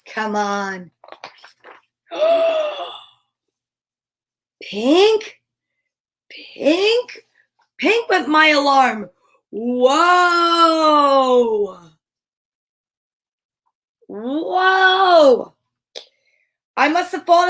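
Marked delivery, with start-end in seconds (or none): 2.1-2.9 sudden high surprised gasp; sharp gasp. Acted. Narrator gasps at the note. 9.5-15.5 long amazed whoa; drawn-out whoa. Acted. Reader reacts in awe.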